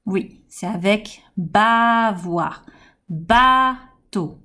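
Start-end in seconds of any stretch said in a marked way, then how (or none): 1.4-2.6 "bavoir" with a long held ba; "bavoir" slowed into two beats; stressed first syllable. Shown as a demonstration. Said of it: "On va accentuer la première syllabe". 3.1-4.4 "Bateau" with a long held Ba; "Bateau" split in two beats; stressed first syllable. Shown as a demonstration. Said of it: "On va accentuer la première syllabe".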